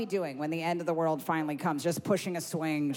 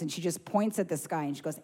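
normal talking voice

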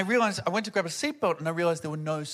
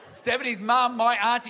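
usual speaking voice

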